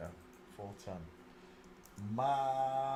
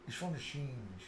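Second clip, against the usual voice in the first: Irish accent; 8 dB quieter than usual. Shown as a demonstration. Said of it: "Now I'm saying it".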